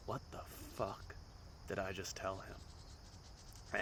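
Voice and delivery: whispered slowly under the breath, horrified; a morning-after whisper of disbelief; panicked whisper. Retold or imitated. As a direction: hiss it to yourself in slow dread. Waking up regretting confession.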